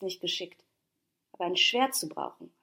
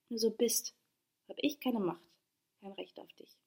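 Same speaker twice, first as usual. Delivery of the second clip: softer, fading into a whisper; mildly tearful resignation; 6 dB quieter than usual. A narrator's reading. Diotima gives up her claim on him, understated sorrow fading to whisper. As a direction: give up quietly, last words almost voiceless. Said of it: "Sie weinte bitter".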